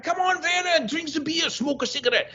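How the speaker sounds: high, coaxing German teammate; German accent. Retold or imitated. German team urging him to party, high mock German accent. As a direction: cajole him like a beery German mechanic. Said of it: "And they're like".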